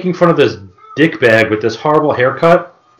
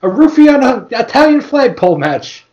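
much higher cartoon voice, laughing; mock meathead; 10 semitones higher than usual. Playing Vito naming his match, high and laughing. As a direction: ham up the dim wrestler.

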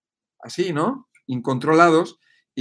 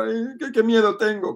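high, whimpering mock fear; mock fearful whine; 7 semitones higher than usual. Mocking a scared person who fears everything, high whimper. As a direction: play a frightened person moaning about danger. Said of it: "Eso es un tono bajo".

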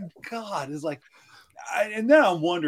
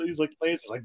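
ordinary talk

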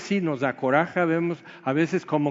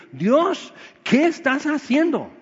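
exasperated outcry; 10 semitones higher, 4 dB louder than usual. Retold or imitated. Job crying out to God.

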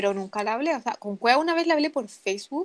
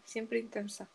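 much softer under-the-breath repeat; quiet echo; 14 dB quieter, slower than usual. Shown as a demonstration. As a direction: echo it to yourself, softly. (eco murmurado, mucho más suave)

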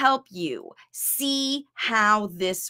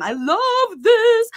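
high, slow gush of delight; excited; 10 semitones higher, 6 dB louder, slower than usual. Real, not acted. Coach gushing over a mom's win, thrilled. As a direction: squeal it with real joy. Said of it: "I'm just getting excited".

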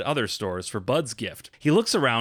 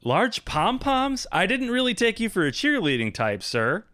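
puzzled clerk; 9 semitones higher than usual. Acted. Clerk mishears the request.

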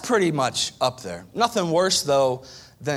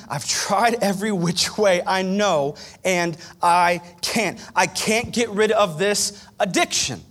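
pleading prayer; 7 semitones higher, 6 dB louder than usual. Acted. Struggling man crying out to God, loud pleading. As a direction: beg God, building up. Voicing desperate prayer, rising.

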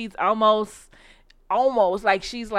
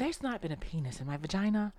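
much softer, hushed retelling; hushed, confiding quote of her claim; mimicking her claim; 13 dB quieter, faster than usual. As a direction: drop to a hush for the quote. Quoting her to the doctor.

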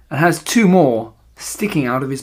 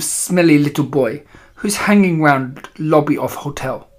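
put-on imperious witch with a foreign accent; accented villainess giving instructions. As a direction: lecture your followers in a thick accent, drawing out key words.

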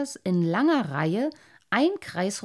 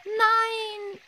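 much higher scream of no; cry out; 14 semitones higher than usual. Acted. Boy screaming in his nightmare, terrified no. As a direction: scream it out in terror. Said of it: "schrie der kleine Wassermann auf".

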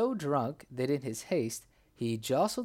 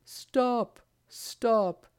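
loud high urgent shouts; desperate cry of Stop; 8 semitones higher, 4 dB louder than usual. A narrator's reading. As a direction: yell to halt the hanging. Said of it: "dashed through the crowd and cried".

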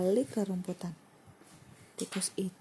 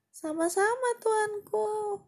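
squeaky mouse; 13 semitones higher, 4 dB louder, slower than usual. Acted. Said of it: "kata tikus".

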